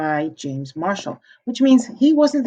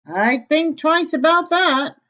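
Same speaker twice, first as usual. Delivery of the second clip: warning man; 8 semitones higher, slower than usual. Acted. The man warns the pig.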